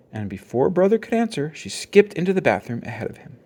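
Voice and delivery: usual speaking voice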